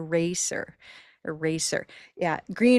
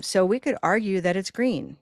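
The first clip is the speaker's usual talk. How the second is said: ordinary talk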